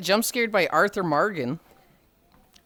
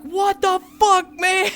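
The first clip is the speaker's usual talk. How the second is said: high indignant outburst; 13 semitones higher, 5 dB louder, slower than usual. Retold or imitated. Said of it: "Yaze was probably like".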